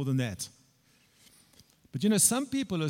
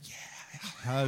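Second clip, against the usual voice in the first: 1 gleeful whisper; 4 semitones lower, 8 dB quieter than usual. Retold or imitated. Silently gloating as lady scolds.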